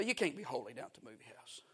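normal talking voice

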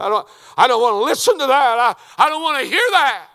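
high, hammered-up yell of refusal; acting out stubborn refusal, very loud and high; defiant shouting. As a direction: shout them down at full force. Acts out people refusing to listen.

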